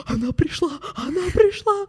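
высокий испуганный вскрик; изобразить панику, визгливо; испуганный возглас. Retold or imitated. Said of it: "такая". (высокий испуганный вскрик)

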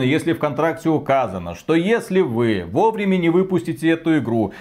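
ordinary talk, nothing marked

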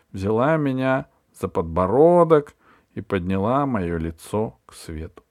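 ordinary delivery, nothing marked

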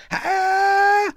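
high piercing shriek. Acted. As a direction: scream in sudden terror. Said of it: "завизжала".

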